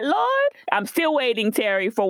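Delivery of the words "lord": high-pitched appeal to heaven; acted-out cry for patience, pitched way up; exasperated plea. Daughter to God after hanging up on mom, exasperated plea.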